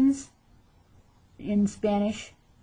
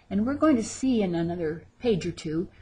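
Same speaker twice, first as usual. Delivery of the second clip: ordinary talk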